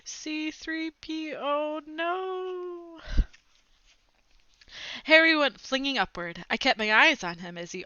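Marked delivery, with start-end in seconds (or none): 0.0-3.0 high, slow, robotic toy voice; a droid-like little mutter. Acted. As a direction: squeak it out like a droid. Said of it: "Harry was muttering something".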